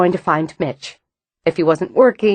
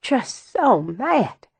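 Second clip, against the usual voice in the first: very slow, quiet sigh; 5 semitones higher, 4 dB quieter, much slower than usual. A narrator's reading. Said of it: "calming them both".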